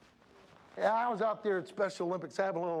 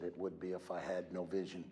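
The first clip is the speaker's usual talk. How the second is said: Helen Keller's words, softly; 12 semitones lower, 8 dB quieter than usual. Retold or imitated. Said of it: "And she said".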